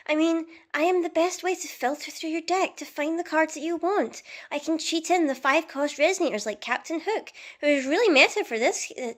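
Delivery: pleading card voice. Acted. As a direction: sulk as a neglected card asking to be played. Card speaks to its owner.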